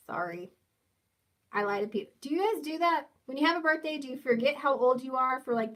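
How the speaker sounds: usual speaking voice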